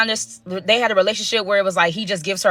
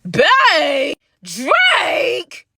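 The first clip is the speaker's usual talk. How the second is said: shocked outburst; 7 semitones higher than usual. Real, not acted. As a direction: blurt out stunned at the word babe. Hearing supervisor call her babe.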